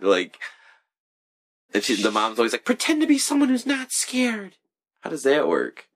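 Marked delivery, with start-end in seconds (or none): 2.6-4.6 high mock mom. Imitating the mom.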